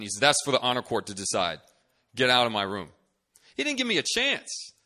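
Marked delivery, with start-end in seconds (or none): 0.2-2.9 loud, stern dismissal at usual pitch; angry professor. Retold or imitated. Professor dismissing an accused student, angry. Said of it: "he was so angry". 3.6-4.7 high, loud complaint about unfairness; indignant protest. Retold or imitated. Student retelling the professor's dismissal, indignant.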